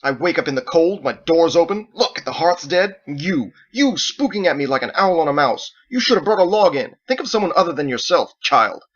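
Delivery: loud, high furious rant; angry rant. Acted. Michael rants at Edward, loud and furious. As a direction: vent every grievance at the boy.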